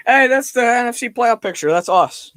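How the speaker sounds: real sudden excitement. Real, not acted. Streamer spotting own team's playoff picture, suddenly excited. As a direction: break off and blurt it out.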